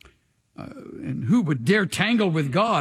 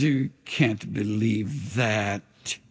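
the enemy's voice; 9 semitones lower, slower than usual. Retold or imitated. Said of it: "and the enemy says".

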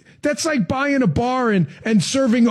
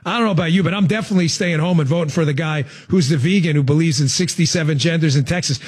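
ordinary delivery

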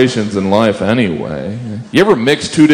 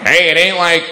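brain surgeon; 7 semitones higher than usual. Retold or imitated. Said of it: "What do brain surgeons say".

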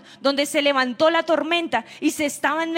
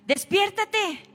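higher, urgent call to wake up; urgent wake-up plea; 5 semitones higher, much slower than usual. Retold or imitated. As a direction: rouse him, pitched up. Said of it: "ellos se llenaron de angustia".

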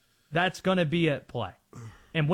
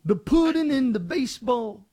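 higher, louder celebrity impersonation; Bill Cosby; 6 semitones higher, 4 dB louder than usual. Acted. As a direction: put on a hammed-up comic impression. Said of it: "that was a Bill Cosby impersonation".

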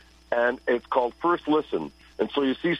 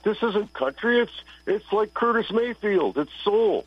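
higher, emphatic correction; insistent retort; 9 semitones higher than usual. Retold or imitated. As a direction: set him straight, it's soul not country. Retorting to friend's country remark.

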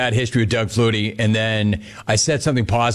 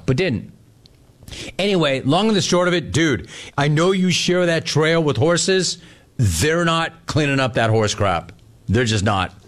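ordinary talk

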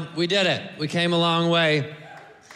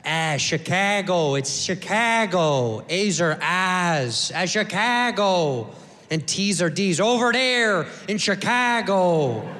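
put-on slow, thick Chicago accent; 3 semitones lower, slower than usual. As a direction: drag out the Chicago vowels. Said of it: "with that thick Chicago accent".

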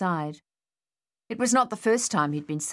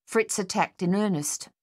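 usual speaking voice

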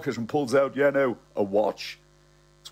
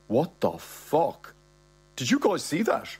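shocked disbelief; 5 semitones higher than usual. Acted. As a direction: voice a stunned reaction. Narrator's shocked reaction voiced.

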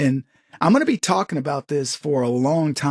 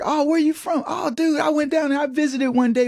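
excited chatter; 11 semitones higher than usual. Retold or imitated. American gushing to a stranger about a visit, high excited chatter. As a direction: play the overeager visitor swapping travel stories.

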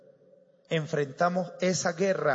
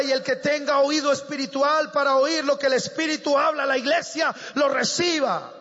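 high, building exhortation; fervent preaching; 8 semitones higher, 6 dB louder than usual. Real, not acted. Preacher calling young people to receive the word, fervent and building. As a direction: climb to an impassioned shouted appeal.

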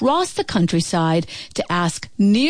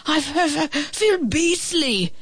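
stammering dragon; 8 semitones higher than usual. A narrator's reading. Sick dragon father tells his son how he feels, stammering. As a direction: stumble over the words, feeling awful.

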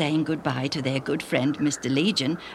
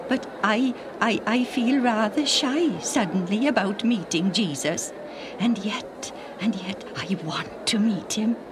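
higher, hesitant, ending in a whisper; shy uncle; 5 semitones higher than usual. A narrator's reading. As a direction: admit shyness, fading to a whisper.

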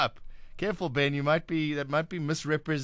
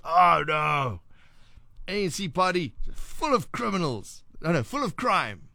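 raised, emphatic imitated complaint; mock dismay; 4 semitones higher, slower than usual. Host acting out the lady's view of the A N C, dismayed and emphatic. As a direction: act out her disapproval with drawn-out emphasis.

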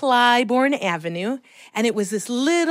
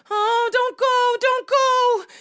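put-on high, drawn-out child's plea; imitated panic, pitched way up; panicked pleading; slower than usual. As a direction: beg friends not to leave you. Child left alone pleads.

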